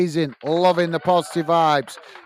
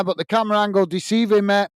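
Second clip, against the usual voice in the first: ordinary talk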